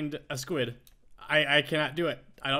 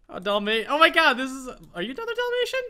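high, breathless-with-delight dog spotting; all excited; 7 semitones higher, 5 dB louder than usual. Real, not acted. Player spotting another Dalmatian, all excited. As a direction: burst out high-pitched in real excitement. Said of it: "I'm getting, like, all excited".

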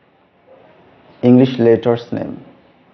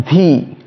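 V higher, leaning on its long "ee"; emphasis on E sound; 5 semitones higher, 3 dB louder than usual. Shown as a demonstration. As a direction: stretch and stress the vowel in V. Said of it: "emphasis goes on E sound".

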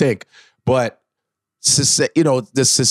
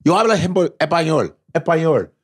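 quick New York Puerto Rican Spanish; Puerto Rican accent; 3 semitones higher than usual. Retold or imitated. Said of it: "like Puerto Ricans when they speak Spanish".

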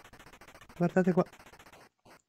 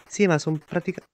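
ordinary talk

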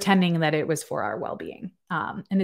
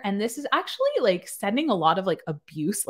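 ordinary delivery

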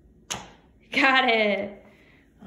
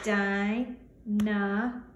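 Dinosaur slowly, ending on a rise; syllables tapped; much slower than usual. Shown as a demonstration. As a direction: stretch Dinosaur into three slow beats. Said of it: "let's tap it out".

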